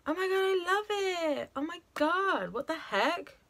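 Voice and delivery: high delighted cry; excited. Real, not acted. Loves the colour.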